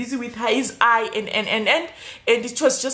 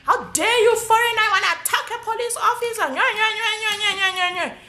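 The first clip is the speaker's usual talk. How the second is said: high, sneering mimicry of a rude cop; mocking cop voice, high and scornful; 10 semitones higher than usual. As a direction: ham up a jeering officer, pitched way up. Mimicking cop's taunt, then nya-nya.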